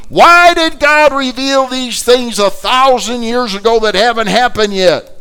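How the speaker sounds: loud, high preacher's cry; fiery preaching. Acted. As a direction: drive the question home at full force. Preaching climax.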